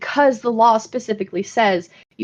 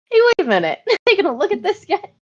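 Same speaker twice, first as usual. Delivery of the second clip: higher, playful questioning quote with a laugh; mock curious check; 9 semitones higher, faster than usual. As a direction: pipe up as if just noticing. Acting out someone jokingly checking.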